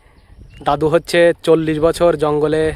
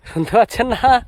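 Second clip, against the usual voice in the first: calling out; 5 semitones higher than usual. Real, not acted. Old man doesn't hear the question.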